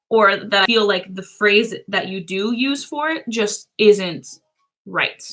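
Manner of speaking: usual speaking voice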